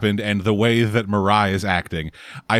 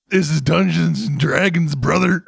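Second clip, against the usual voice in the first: bro voice; 7 semitones higher, 4 dB louder than usual. Pretend. Bro voice joke.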